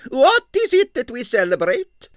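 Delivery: high, loud eager foreign voice; excited diva. Acted. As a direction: gush with curiosity. Arienskaya excited arrival.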